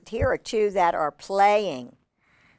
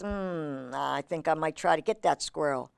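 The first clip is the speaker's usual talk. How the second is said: a hint of a scheming hawk, low and softer; lightly voiced hawk; 4 semitones lower, 7 dB quieter than usual. Hawk eyeing the squirrel, lightly acted low musing. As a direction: think it over quietly as the hawk, lightly. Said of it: "The hawk thinks".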